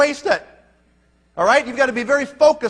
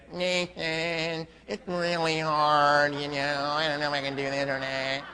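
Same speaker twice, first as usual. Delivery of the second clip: slow, low nasal whine, mocking; nasal whiner; 8 semitones lower, 8 dB quieter than usual. Leader mimics a whining recruit on the phone, nasal voice. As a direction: drag it out as a mock nasal complainer. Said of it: "Don't call me with that nasal voice".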